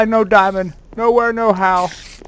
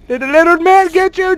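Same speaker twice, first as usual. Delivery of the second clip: normal talking voice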